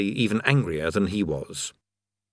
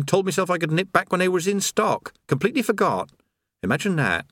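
put-on higher, breezy voice of a chatty old workman; chatty creator; 4 semitones higher than usual. Creator chatting idly beside a falling man. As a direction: natter on as if all is fine.

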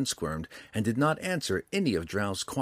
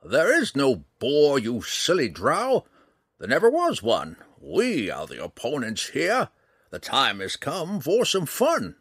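relishing the challenge, stretched-out words; gleeful old ranger; 5 semitones higher, 6 dB louder than usual. A narrator's reading. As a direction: crow over the trick in character. Old ranger gleefully reveals the trick.

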